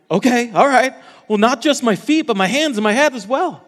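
over-eager Peter. Acted. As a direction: gush like Peter wanting the whole bath. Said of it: "Peter is, is so extreme".

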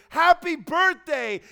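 high, loud happy birthday; celebratory shout. Acted. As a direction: call it out with joy. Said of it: "meant to celebrate".

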